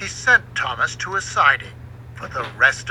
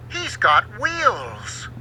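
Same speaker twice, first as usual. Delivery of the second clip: surprised realization; 5 semitones higher, much slower than usual. A narrator's reading. Surprised discovery of wheels.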